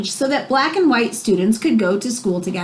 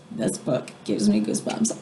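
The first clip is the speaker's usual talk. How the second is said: whispered aside; 11 semitones lower, 7 dB quieter than usual. Real, not acted. Reader moved by the book.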